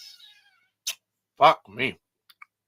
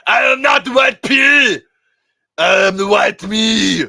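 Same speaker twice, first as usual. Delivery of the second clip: much louder, higher Arnold bark; Schwarzenegger impression; 10 semitones higher, 15 dB louder than usual. Schwarzenegger scolding Stallone on the phone, loud Arnold voice. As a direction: shout at him like Arnold Schwarzenegger. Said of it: "and Schwarzenegger".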